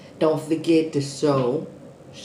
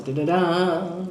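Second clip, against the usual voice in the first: ordinary delivery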